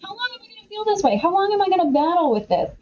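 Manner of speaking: high, pleading cry of How long, half acted; anguished lament. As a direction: voice a psalmist crying out to God, mock anguished. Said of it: "he's constantly like".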